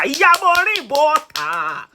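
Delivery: high, drawn-out loud call; shouted summons. Real, not acted. Vlogger calling Maureen's mother out, shouted summons. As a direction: holler it out, stretching the words.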